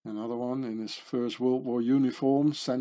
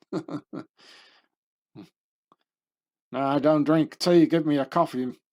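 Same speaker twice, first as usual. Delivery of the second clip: gruff Patton retort; 3 semitones higher, 6 dB louder than usual. Retold or imitated. Patton refusing Montgomery's tea, gruff and loud. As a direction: bark it back like a tough general.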